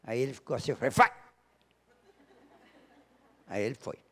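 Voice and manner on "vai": sudden loud order; loud, abrupt parental command; retold sharp command. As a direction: fire the word out loud, like an order.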